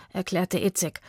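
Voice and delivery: ordinary delivery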